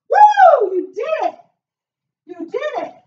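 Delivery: high loud celebration; excited cheer. Real, not acted. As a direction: cheer them on. Celebrating finished workout.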